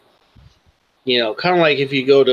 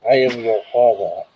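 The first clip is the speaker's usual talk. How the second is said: talking into a glass; 3 semitones higher than usual. Acted. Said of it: "Are you speaking into a glass?".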